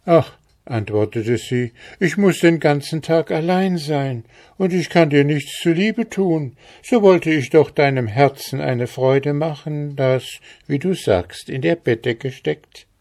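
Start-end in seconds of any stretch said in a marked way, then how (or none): 0.0-0.4 put-on high young girl's voice; a plaintive young maiden; lightly voiced girl. A narrator's reading. Girl's sad plea. 2.0-12.9 put-on higher, earnest girl's voice; sweet girl pleading her case; young girl. A narrator's reading. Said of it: "Lachend fragte er das Mädchen".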